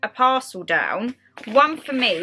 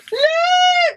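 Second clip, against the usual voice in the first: high, loud excited squeal; excited shout; 5 dB louder than usual. Real, not acted. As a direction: squeal it out in delight. Showing off a surprise gift.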